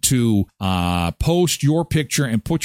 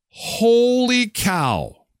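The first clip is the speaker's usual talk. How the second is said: slow, high, drawn-out holy cow; mock shock; 8 semitones higher, much slower than usual. Host reacting to seven-dollar water, drawn-out mock shock. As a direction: stretch it out in disbelief.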